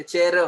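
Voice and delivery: "चेयर" broken into two beats, "che-ro"; wrong double syllable. Shown as a demonstration. Teacher showing what cannot be done, "चेयर" as two syllables. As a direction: add a loud extra syllable to "चेयर". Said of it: "double syllable नहीं बना सकते".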